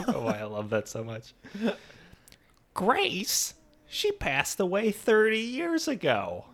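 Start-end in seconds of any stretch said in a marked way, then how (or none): 2.7-6.4 put-on high, slow old-lady voice; a confused old aunt; hammed-up high elderly aunt.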